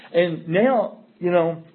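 usual speaking voice

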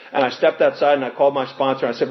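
normal talking voice

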